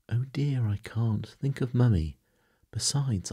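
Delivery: high-pitched, fretful refusal; distressed protest. A narrator's reading. Wendy protests at being asked away.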